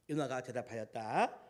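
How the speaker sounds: usual speaking voice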